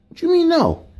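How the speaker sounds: high, outraged what you mean; can't believe she said no; indignant disbelief. Acted. Shocked at her refusal.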